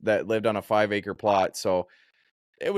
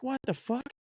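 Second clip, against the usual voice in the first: high, soft "what on earth" squeak; baffled, pitched way up and quieter; mock-baffled, voice jumping high; retold bewilderment; 14 semitones higher, 7 dB quieter than usual. Bewildered at surprise pizza, retold.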